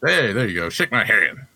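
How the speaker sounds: ordinary delivery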